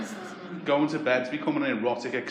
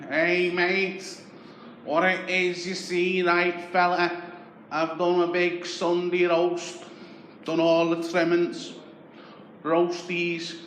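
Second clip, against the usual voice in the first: higher, wheedling Scouse scrounger; butter him up like a chancer on the doorstep; Scouse beggar voice; 5 semitones higher, slower than usual. Retold or imitated. Voicing local beggar Tony.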